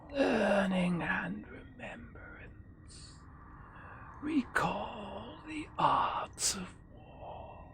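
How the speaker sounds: slow, high, eerie ghost whisper. Shown as a demonstration. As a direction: whisper it like a lost spirit. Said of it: "gotta read in the ghost voice".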